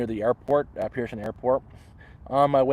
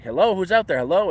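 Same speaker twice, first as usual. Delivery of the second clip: louder, higher retold call into the dark; 7 semitones higher, 6 dB louder than usual. Camper calls to footsteps outside his tent, retold calling out. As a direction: mimic calling out to an unseen prowler.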